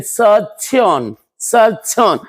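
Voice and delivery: सर्जन said slowly in two separated syllables, सर-जन; drawn-out sounding out. Shown as a demonstration. Teacher reading out a misspelling for students, sounded out slowly. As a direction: stretch both halves of सर्जन. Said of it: "तो लीजिए सर्जन का spelling".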